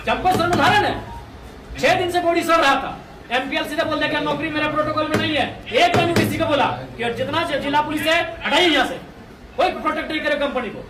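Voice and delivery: loud, forceful demand; loud protest. Real, not acted. Protest leader to officials about police guarding the company, loud and forceful.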